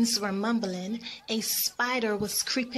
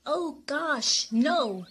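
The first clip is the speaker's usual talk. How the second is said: higher-pitched shocked refusal; gasped horror; 5 semitones higher, much slower than usual. Acted. Mosquito refusing a feast of blood, gasping. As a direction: gasp it out, aghast. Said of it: "Niagara gasped".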